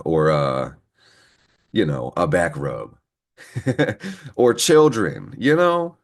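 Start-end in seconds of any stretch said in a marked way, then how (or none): 3.5-4.3 a quick high laugh at her own joke; high burst of laughter. Real, not acted. Laughing at her own joke to viewers, a quick high laugh. Joking about what you can't provide. 4.4-6.0 laughing. Real, not acted. Laughing through her own joke.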